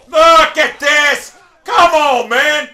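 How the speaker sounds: high, drawn-out protest; pleading, angry outburst; exasperated shout. Real, not acted. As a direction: yell in real frustration at the game. Player protesting at the game.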